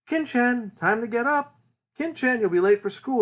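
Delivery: high sing-out wake-up call; mother calling. Retold or imitated. Mother waking her son for school, calling out.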